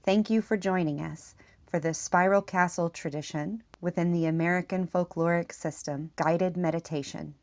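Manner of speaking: measured meditation voice with long pauses; spoken meditation script, leaving room to breathe; guided meditation. Acted. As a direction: guide the listener inward, phrase by unhurried phrase.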